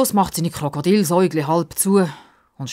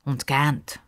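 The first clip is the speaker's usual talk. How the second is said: ordinary delivery, nothing marked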